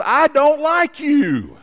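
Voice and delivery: put-on boy yelling his dislike to the mountains; calling out. Irritated boy calls to echo.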